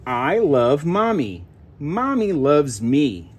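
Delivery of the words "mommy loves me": usual speaking voice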